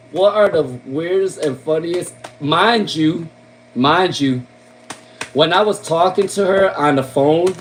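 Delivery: ordinary talk, nothing marked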